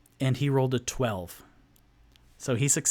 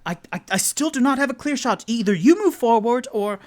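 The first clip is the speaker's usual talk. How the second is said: high, loud, fast anxious voice; character voice; 10 semitones higher, 7 dB louder, faster than usual. Acted. Tipsy urging Rock from behind, high and hurried. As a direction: rush it out, voice pitched up. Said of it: "Tipsy going".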